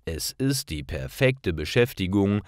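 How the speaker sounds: ordinary delivery, nothing marked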